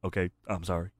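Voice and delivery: very low, soft mumbled apology; mock sheepish apology. Big Show backing down to Tori, mock sheepish apology. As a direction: back down quietly in a low imitated voice.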